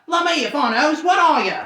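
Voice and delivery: high, loud baffled troll; puzzled troll. Acted. Trolls puzzling over Bilbo, loud bafflement. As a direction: gawp at the strange creature.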